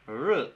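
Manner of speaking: "R" sounded alone, drawn out with a short vowel; Mapudungun R sound. Shown as a demonstration. Teacher repeating it for learners, Mapudungun R sound. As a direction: repeat the bare sound "R" as a model. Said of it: "se pronuncia como R".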